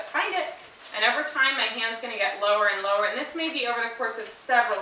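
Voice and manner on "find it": bright high-pitched dog cue. Real, not acted. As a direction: lift the voice way up to send the dog. Cue to dog, high sing-song.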